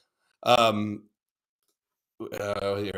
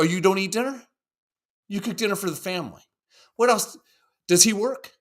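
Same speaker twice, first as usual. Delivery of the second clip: higher, fast interrogating host voice; grilling radio host; 8 semitones higher than usual. Retold or imitated. Radio host Doctor Laura grilling a caller, quick and pointed. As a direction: fire questions like a tough radio therapist.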